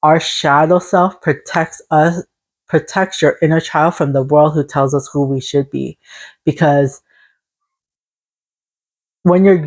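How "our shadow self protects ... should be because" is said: normal talking voice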